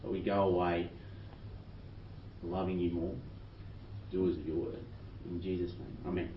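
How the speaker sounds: quiet, low prayer voice; hushed prayer ending in amen; soft closing prayer. Real, not acted. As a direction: close the prayer gently and softly.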